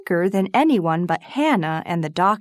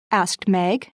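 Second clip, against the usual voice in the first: ordinary delivery, nothing marked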